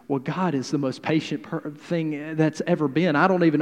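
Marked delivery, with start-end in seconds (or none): none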